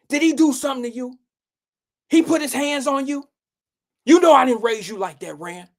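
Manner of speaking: mock mother defending the girlfriend; protective mom. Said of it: "them mamas that'll be taking up for the girlfriends".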